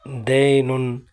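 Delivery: normal talking voice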